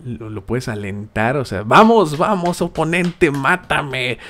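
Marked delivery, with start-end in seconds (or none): 1.6-4.2 loud, high mock cheering of the opponent; playful cheering, louder and higher. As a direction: cheer him on like a fan, hammed up.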